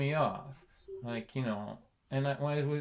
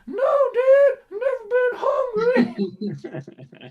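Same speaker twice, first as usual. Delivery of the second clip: very high, loud, tearful whine; hammed-up cry, drawn out at the end; mock wailing son; 10 dB louder, much slower than usual. Son answering his dad at the fridge, mock wail.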